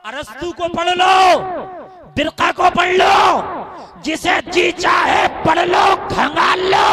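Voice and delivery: high shouted challenge; full-force preaching. Real, not acted. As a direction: dare the crowd, ringing on each ending. (चिल्लाकर चुनौती देते हुए)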